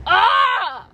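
sharp, high startled cry; startled yelp. Real, not acted. Nearly crashing into another driver, startled yelp. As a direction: yelp as someone cuts in front.

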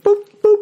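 little cartoon blips, very high; high-pitched booping. Mind lighting up with many crushes, high booping. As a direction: pop out tiny high boops. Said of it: "in my mind's like".

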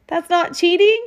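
put-on a boy half-hoping it's allowed; worried boy's question. A narrator's reading.

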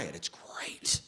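slow breathy whisper; soft, slow whispered aside. Acted. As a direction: whisper it like a secret tip. Whispered aside about crying.

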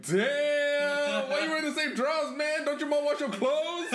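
high, fast mocking voice; teasing homeboys. Homeboys teasing their friend about his drawers, high and mocking. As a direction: rag on him in a high voice. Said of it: "all his homeboys were like".

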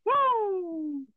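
high wrestler-style woo yell. Pretend. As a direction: cut loose with a wrestling woo.

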